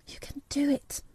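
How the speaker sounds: high, hushed urging under the breath; will him on softly, in secret; to herself. A narrator's reading. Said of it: "said Mary to herself".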